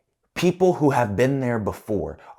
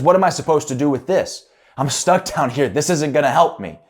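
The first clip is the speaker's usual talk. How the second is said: frustrated cry; 7 semitones higher, 6 dB louder than usual. Retold or imitated. Man in hole, frustrated.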